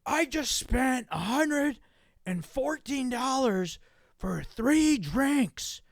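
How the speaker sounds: high, drawn-out mock yell; slow, high-pitched outraged holler; imitated angry yelling. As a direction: stretch every word like a ranting customer. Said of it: "he just starts going".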